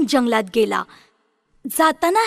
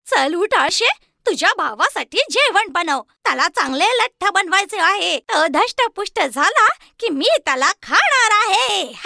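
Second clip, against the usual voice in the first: higher, cruel witch voice; bossy witch; 7 semitones higher than usual. Acted. Witch bossing Gretel to fatten her brother, higher and cruel. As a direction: order her about with relish.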